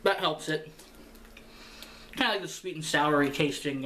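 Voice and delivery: chewing while talking; speaking around food; mouth full. Real, not acted. Seen 2: bites food at -3, chews, food visible in mouth 3.2.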